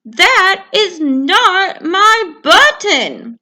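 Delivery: hammed-up upset Toad; crying Toad. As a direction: near tears, drag it out. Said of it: "cried Toad".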